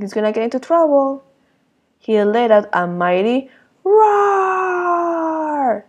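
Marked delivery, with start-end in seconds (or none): 2.0-5.8 slow, high, shouted read-aloud roar; mighty roar. A narrator's reading. Reading to children, building up to Sid's roar with a slow shout.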